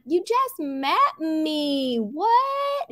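imitating Sutton, slow then a high squeak on what; mock Sutton, drawn out and baffled. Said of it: "in her Sutton voice".

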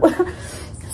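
soft breathy giggle; whispered giggle. Real, not acted. Mom giggling quietly at her own joke. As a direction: giggle under your breath.

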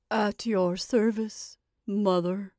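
slow, stifled puppet voice; obedient Scarecrow. Acted. Scarecrow answers its witch mother, slow and obedient. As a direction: answer dutifully, slow and subdued.

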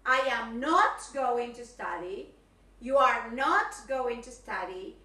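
not said with a rising push, slow and loud; stress on not. Shown as a demonstration. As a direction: hit the word not. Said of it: "Estoy dando ejemplos".

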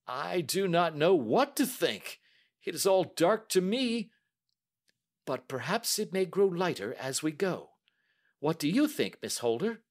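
the banker admitting he is baffled; bewildered banker. A narrator's reading. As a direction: sound lost and confused. Banker voiced while reading.

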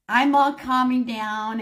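ordinary delivery, nothing marked

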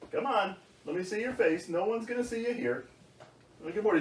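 usual speaking voice

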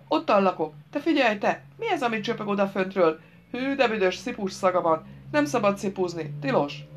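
put-on lightly childish prattle; nosy fool scolding about a forbidden thing; village simpleton, curious.

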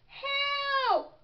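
high, stretched cry for help; storybook panic, held on one high note. A narrator's reading. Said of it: "cried Froggy".

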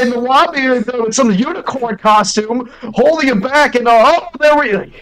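high, urgent play-by-play; mock sports commentary. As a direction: call the disaster as it happens. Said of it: "sports commentary".

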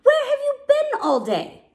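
high, loud demanding question; scolding call. A narrator's reading. Zazu to cubs gone all day, scolding. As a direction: call them to account.

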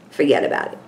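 New York "Forget about it" said like a Brooklyn local; New York accent. Pretend. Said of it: "my New York accent".

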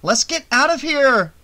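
loud, high-pitched urgent yell; alarmed, high holler; panicked shout. A narrator's reading. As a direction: yell it to flee right now. Panicked escape from attacker.

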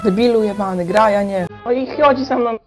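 usual speaking voice

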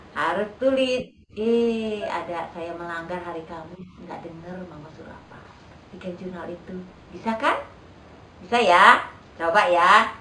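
ordinary talk, nothing marked

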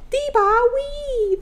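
high, drawn-out mock whine; imitated Sims complaint. Player mimicking Sims who can't reach it, high mock whine. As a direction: mimic a whiny little Sim noise. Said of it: "doing that little".

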